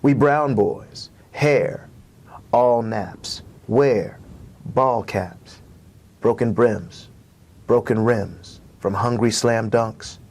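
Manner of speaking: slow, measured reading with long pauses; poem reading. Acted. Poet performing his poem for the host, slow and rhythmic. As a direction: let each image land on its own.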